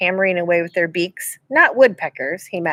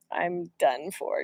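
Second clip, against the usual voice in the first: much softer, very slow inner voice; despairing thought; 10 dB quieter, much slower than usual. A narrator's reading. Jack thinking as the grizzly roars, very soft and slow. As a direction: let it sink out quietly. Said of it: "Jack thought".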